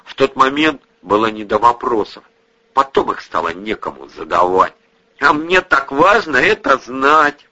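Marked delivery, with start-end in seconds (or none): 5.2-7.5 nightmare moaning. A narrator's reading. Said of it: "пробормотал во сне забинтованный и всхлипнул".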